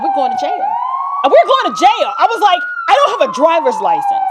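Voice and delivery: mock panic, building; freaking out. Pulled over by police, mock panic rising. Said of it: "I'm freaking out".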